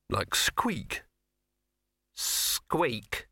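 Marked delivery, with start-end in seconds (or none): 2.1-3.3 higher, drawn-out icy mouse reply; cold mouse. Acted. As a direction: stretch the word out coldly as the mouse. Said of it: "said the mouse coldly".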